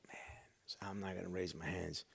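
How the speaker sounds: low, very soft grumbled thought; reluctant muttering. Retold or imitated. Told to raise his hands, reluctant muttering. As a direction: mutter a refusal under your breath.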